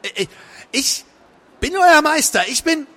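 high, loud stammering; stammer, then insist frantically; flustered, tripping over words. A narrator's reading. Crowley to the mocking being, flustered stammer. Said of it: "verhaspelte sich".

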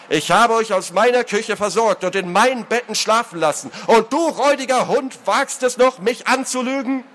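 high, mounting indignation; outraged tirade. Acted. Lord berating the man he fed, outraged.

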